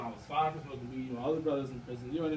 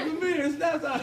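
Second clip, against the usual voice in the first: calling nap time; 9 semitones higher, 5 dB louder than usual. Retold or imitated. Uncle repeating the nap order.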